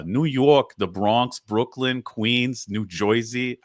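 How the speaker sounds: roll-call the boroughs in broad New Yorkese; put-on thick New York accent. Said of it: "was that accent offensive?".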